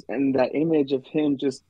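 usual speaking voice

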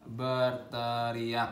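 "Berteriak" stretched syllable by syllable on a low, level pitch; very slow, chanted "Berteriak", not shouted; drawn-out mock yell. Shown as a demonstration. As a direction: draw out every vowel of "Berteriak". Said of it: "ngegas lah".

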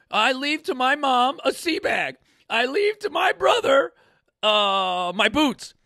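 high, loud, comically proclaimed bequests; mock-solemn shout, pitched well up; mock will declaration. Marine recalling his will at eighteen, mock proclamation. Mimics himself dictating teenage will.

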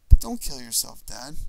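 loud, urgent plea; child's plea. Acted. Small son begs his father in the car, loud and urgent. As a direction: beg him not to do it.

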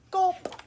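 a high, loud swallowing noise; loud gulp. A narrator's reading. Baby swallows the food.

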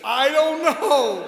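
loud, slow, drawn-out yell; stretched out loud I don't know; exasperated shout. Acted. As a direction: bellow the punchline in frustration. Famous shouted punchline.

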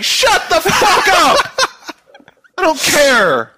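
high, loud, drawn-out mock yell; hammed-up impatient shout; exasperated mock outburst. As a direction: play up being totally fed up, yelling it. Retelling his bored reaction to pitch.

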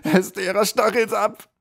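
high, loud anguished cry; desperate scream. A narrator's reading. Tortured troll screams out the witch's name in panic. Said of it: "schrie der Troll in höchster Not".

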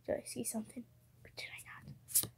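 breathy aside whisper. Real, not acted. Wondering aloud to viewers in a hushed whisper. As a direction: whisper it to yourself, teasing. Teasing about a spotted card.

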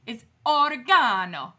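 loud mock-Italian oregano, long GAH; mock Italian accent. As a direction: lean hard into an Italian o-ri-GAH-no. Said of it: "do it with an Italian accent".

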